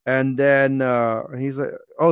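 usual speaking voice

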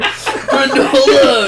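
high, long drawn-out yell; goofy hammed-up battle cry; Rad Chad catchphrase. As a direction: holler it way up high, stretched out.

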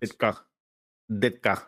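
clipped Chicago fan bit; lightly put-on Chicago accent. Shown as a demonstration. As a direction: say it like a Chicago local. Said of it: "has the best Chicago accent".

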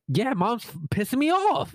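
high, put-on little boy grumble; imitating kid. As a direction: play the grumpy toddler again. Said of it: "she's like".

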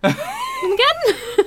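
a high, incredulous "Again?"; mock disbelief. Friend retelling her reply to Sean's prediction, in high mock disbelief. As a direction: squeak it like you can't believe it. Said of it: "And I was like".

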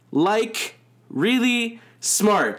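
quoting the tweet slowly with a mocking lift; mock quote. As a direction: lean on really smart mockingly. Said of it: "And it says".

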